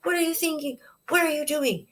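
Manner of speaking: put-on high-pitched furious demand at the child; angry scolding parent. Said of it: "you're not gonna come at that child and say".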